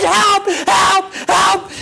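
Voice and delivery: repeated high, loud cries of panic; screaming for help. Retold or imitated. Man facing a dripping-toothed lion, screaming for help. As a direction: yell out in hammed-up terror, one burst at a time. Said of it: "And I'm screaming".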